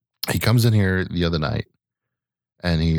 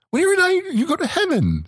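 put-on high, singsong parent-to-child voice; mock-cheery high-pitched comforting; improvised reassurance. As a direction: pitch it way up for a little kid.

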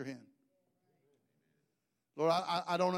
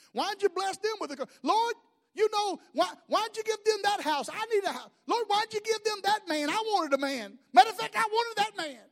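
much higher, rising to louder, mocking whine; hammed-up jealous whine; 12 semitones higher, 5 dB louder than usual. Envious woman demanding God give her things, high mock whine.

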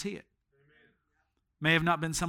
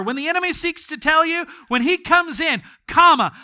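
louder, higher, mounting preaching; building sermon; 6 semitones higher, 6 dB louder than usual. Acted. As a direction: build the sermon toward a climax. Preacher builds to full force.

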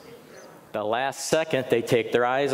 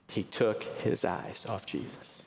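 whispered, slow and much softer; whispered repeat; 2 semitones lower, 8 dB quieter than usual. Shown as a demonstration. Preacher repeats the point to the congregation in a whisper. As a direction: breathe the line again for emphasis.

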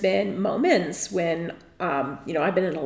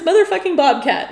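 mock yell; 8 semitones higher, 8 dB louder than usual. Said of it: "All I heard was".

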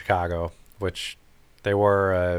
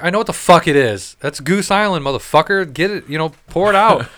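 loud, high, exasperated tirade at the clerk; retold angry rant; 7 semitones higher, 5 dB louder than usual. Telling off the guy who said they're out, angry and loud. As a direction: tear into him like he's an idiot. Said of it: "I'm like".

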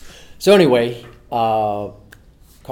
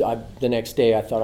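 usual speaking voice

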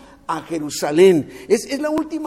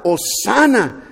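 loud, long-drawn Hosanna; crying out; 6 dB louder, much slower than usual. Acted. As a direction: proclaim it like a cheering crowd. (clamor fuerte y prolongado)

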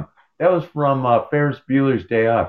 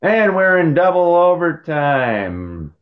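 drawn-out, high-pitched game call; mock sportscaster; 5 semitones higher, slower than usual. Pretending to announce double overtime, mock play-by-play. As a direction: stretch it out like a hockey commentator.

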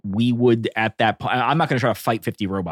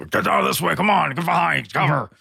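urgent call to clear out; 6 semitones higher than usual. Acted. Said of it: "trying to be like, you know".

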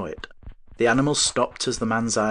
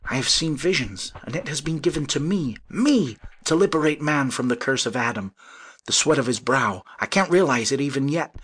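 raised, fervent visionary voice; exclaiming; 6 semitones higher, 4 dB louder than usual. A narrator's reading. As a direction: marvel aloud at your own fortune. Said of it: "he exclaimed".

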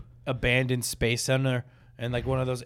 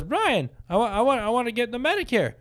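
high, whiny-eager imitation of a caller; raised pleading prank voice; 7 semitones higher than usual. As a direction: badger the scammer by name, pitched up. Retelling Christoph pleading with scammer.

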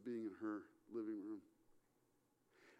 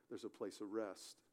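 ordinary talk, nothing marked

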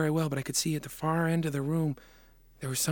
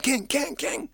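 calling out; 10 semitones higher, slower than usual. Acted. Boy shaking king awake.

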